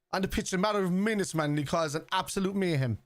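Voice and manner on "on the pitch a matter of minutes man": ordinary talk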